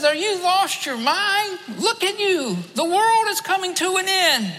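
a frantic stranger pleading with a dreamer who seems mad; panicked stranger. Retold or imitated. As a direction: yell it like the world is ending. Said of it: "He says".

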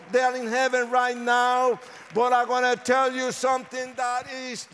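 high, loud revival preaching, shouting the promise; full-force preaching. Acted. Pastor to the congregation about breaking curses, preaching at full force. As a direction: proclaim it like a revival preacher calling down power.

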